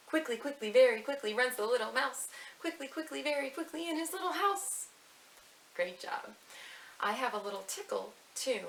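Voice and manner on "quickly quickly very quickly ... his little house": high, louder and bouncy like a scurrying mouse; quick and lively. Shown as a demonstration. Teacher reciting to little ones, quick and high. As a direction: rattle it off high and bright. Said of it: "say the quickly mouse part quickly".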